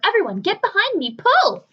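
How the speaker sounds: very loud, high rallying call; rally everyone to pull hard; shout. Acted. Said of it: "shouted the old green grasshopper".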